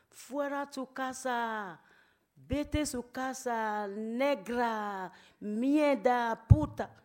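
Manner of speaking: slow mocking insults building to a loud shout. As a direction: spit out the slurs, rising to a scream at the end. Retold racist abuse on bus. (insultos lentos que acaban en grito furioso)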